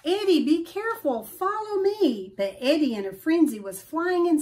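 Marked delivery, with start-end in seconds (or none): 0.0-2.3 higher, fast urgent calling-out. Acted. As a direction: shout a warning to a friend mid-air. Al calls to Eddie in flight.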